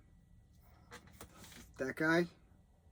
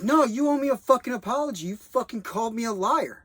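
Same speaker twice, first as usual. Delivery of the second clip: high, loud furious yelling; yelling at the guard for an apology; angry yell; 9 semitones higher, 8 dB louder than usual. Retold or imitated. Man yelling at the guard, loud and high. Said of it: "And the guy's like".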